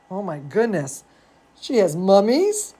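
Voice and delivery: plain reading voice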